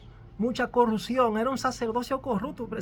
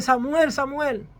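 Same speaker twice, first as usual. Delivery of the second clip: slow, higher, loud call of the name; 4 semitones higher, 7 dB louder, much slower than usual. Acted. God calls the sleeping boy Samuel, slow and loud. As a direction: call him across the dark temple.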